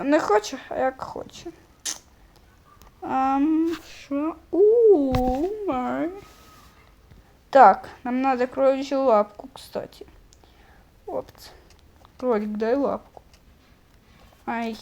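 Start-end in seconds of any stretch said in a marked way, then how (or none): none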